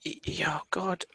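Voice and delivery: breathless whisper of dread; hushed oh God under the breath. Real, not acted. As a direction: whisper it like bad news just landed. Dreading the consequence roll.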